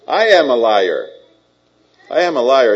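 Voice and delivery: normal talking voice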